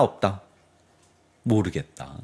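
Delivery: normal talking voice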